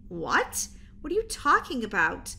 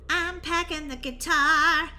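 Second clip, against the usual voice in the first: ordinary talk, nothing marked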